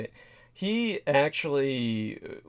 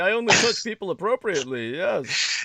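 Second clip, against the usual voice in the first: mock governor voice; 8 semitones higher than usual. Mocking governor's denial.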